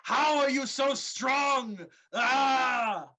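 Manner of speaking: big booming brute bellowing; roaring giant. Acted. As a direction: roar it like a raging giant. GM voices the giant mid-fight.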